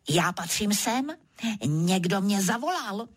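ordinary delivery, nothing marked

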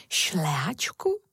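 slow, low start swinging up into a question; puzzled wonder. A narrator's reading. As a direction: draw the word out in puzzlement. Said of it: "podivila se Moja". (protáhle a udiveně)

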